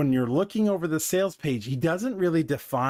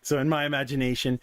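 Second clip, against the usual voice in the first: normal talking voice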